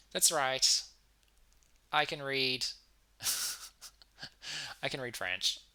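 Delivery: normal talking voice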